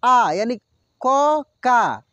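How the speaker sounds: drawn-out syllable demo. Shown as a demonstration. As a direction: spell out क then का slowly. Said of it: "देखिए". (धीमे से खींचकर क, फिर का)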